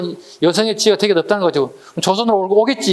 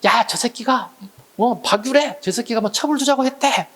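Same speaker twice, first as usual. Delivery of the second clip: higher-pitched scornful jeer at a man passing by; mock cursing; 6 semitones higher than usual. A teacher voices an angry Goryeo woman jeering at the minister, higher mock yell. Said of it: "막 욕을 해".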